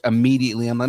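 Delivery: normal talking voice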